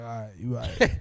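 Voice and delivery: backing down. Retold or imitated. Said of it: "the guy was like".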